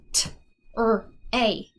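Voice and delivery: "T, er, a" said as separate sounds; segmenting sounds. Shown as a demonstration. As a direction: split the word into its sounds. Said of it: "I would go".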